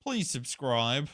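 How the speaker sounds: light, put-on begging; mock begging. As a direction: playfully plead with the audience, drawing it out. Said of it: "where I beg for subscribers".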